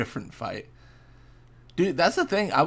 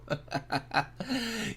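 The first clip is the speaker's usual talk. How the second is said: high falling laugh; 11 semitones higher, 6 dB quieter than usual. Real, not acted. Laughs at the game's prompt.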